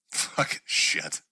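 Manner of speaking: whispered, low curse; hushed swearing aside; under-breath curse. Real, not acted. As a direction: mutter it to yourself, whispered. Cursing under breath after slip.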